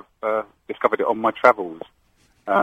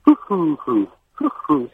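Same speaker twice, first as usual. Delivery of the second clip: higher hooting coo, falling; London pigeon coo; 5 semitones higher, much slower than usual. Shown as a demonstration. Caller redoes the London pigeon for the host, higher hoots. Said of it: "do the London one again".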